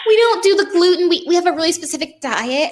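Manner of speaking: high, put-on precious voice; hammed-up high-voiced food snob; mock fussy eater. Mocks overly sensitive special-diet people.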